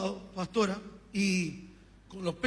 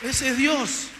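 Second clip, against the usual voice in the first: loud, emphatic preaching; strong loud declaration of faith; loud proclamation; 3 semitones higher, 6 dB louder, much slower than usual. Real, not acted. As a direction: proclaim it to the church. Preacher's climax line.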